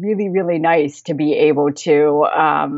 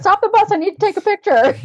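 mock shout, much higher and louder; scream; 4 dB louder than usual. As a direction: yell it like begging the driver to stop. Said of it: "wanted to scream that".

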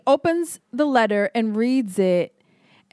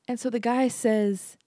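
ordinary talk

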